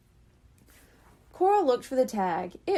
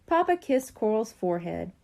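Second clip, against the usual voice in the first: ordinary delivery, nothing marked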